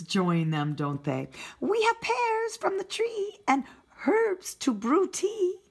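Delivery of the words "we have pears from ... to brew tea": chirpy birds. Acted. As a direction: sing it out like a flock of friendly birds. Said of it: "said the birds".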